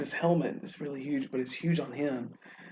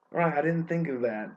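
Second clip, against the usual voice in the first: normal talking voice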